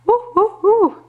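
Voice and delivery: high, loud repeated hoots; monkey hoots. Pretend. Parent answers for the monkey, high hooting. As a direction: whoop like a monkey. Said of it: "What about the monkey?".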